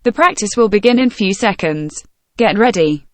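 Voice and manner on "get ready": ordinary talk, nothing marked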